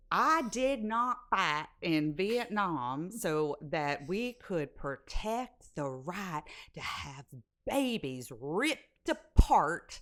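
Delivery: slow, stretched-out Southern representative; hammed-up Southern drawl, word by word; mock Southern accent. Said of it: "I'm doing the Southern accent".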